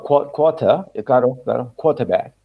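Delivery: quarter y quarterback sin R, "kota"; British quarter. Shown as a demonstration. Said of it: "sin la R". (quarterback británico sin R)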